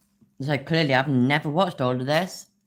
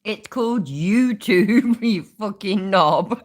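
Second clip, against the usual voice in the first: loud, slow, laughing jeer; 6 semitones higher, 6 dB louder, slower than usual. Real, not acted. Mocking the streamer.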